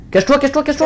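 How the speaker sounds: urgent shout. Real, not acted. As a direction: yell at a friend to hide now. Urging partner to hide.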